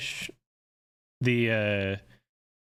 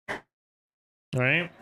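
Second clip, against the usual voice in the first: ordinary delivery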